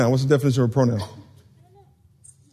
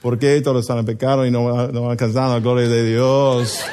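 low, lilting Mexican Spanish line; melodic Mexican Spanish; 8 semitones lower than usual. Shown as a demonstration. Preacher demonstrates a Bible verse for the congregation, melodic Mexican Spanish. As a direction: let it flow like sung Mexican Spanish. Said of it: "It's just very melodic like that".

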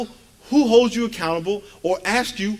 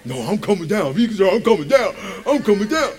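imitating frantic wife; 3 semitones higher, 4 dB louder than usual. Husband mimicking wife at football game, mock-frantic insistence. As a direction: build it into a hammed-up protest. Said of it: "my wife's like a wreck".

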